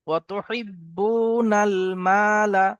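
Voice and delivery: slow drawn-out melodic chant; Quran recitation. Acted. Teacher reciting the verse for students, slow melodic chant. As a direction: intone it slowly, stretching the vowels.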